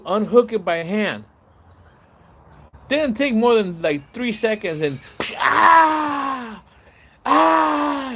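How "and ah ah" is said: high, loud pained yell; retold scream. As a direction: howl like the stung guy. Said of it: "he starts screaming loud".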